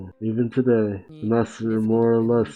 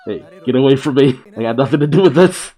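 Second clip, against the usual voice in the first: loud panicked yell; 4 semitones higher, 9 dB louder than usual. Acted. Pushing someone away, panicking.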